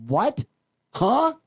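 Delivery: high, loud incredulous "What? Huh?"; mock disbelief. Host imitates the stunned world media, high and loud. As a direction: sound shocked and baffled, overdone. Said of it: "worldwide media was like".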